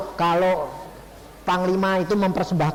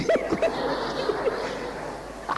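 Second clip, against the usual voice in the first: high unvoiced giggle; preacher giggling at the reading choice; breathy laugh; 5 dB quieter than usual. Real, not acted. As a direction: giggle breathily through the air. Laughing at God's joke.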